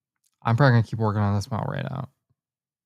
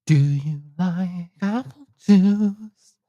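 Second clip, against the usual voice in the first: high, very slow silly voice; put-on character voice; 6 semitones higher, much slower than usual. Shown as a demonstration. Another practice take of his line, high and slow. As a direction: draw the question out high and slow.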